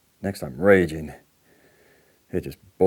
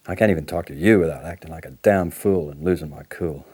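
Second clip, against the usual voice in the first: normal talking voice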